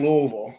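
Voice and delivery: Louisville as a slurred "LOO-vull"; local pronunciation. Shown as a demonstration. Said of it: "Not Louisville, not Louisville".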